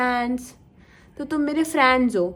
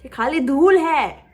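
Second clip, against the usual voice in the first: slow, louder scoffing line; mocking tone; 5 dB louder, slower than usual. Shown as a demonstration. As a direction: drag it out with scorn. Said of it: "मैं इस tone में ही बोल रही थी".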